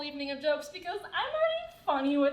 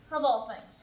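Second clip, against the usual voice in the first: ordinary talk, nothing marked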